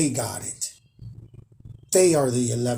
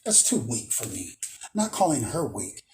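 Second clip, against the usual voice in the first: ordinary delivery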